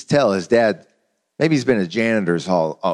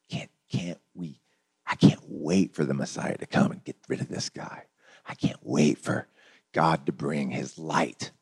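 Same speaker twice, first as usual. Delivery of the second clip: longing crowd; 8 semitones lower, 8 dB quieter than usual. Retold or imitated. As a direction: murmur the wish under your breath. Voicing longing crowd for Messiah.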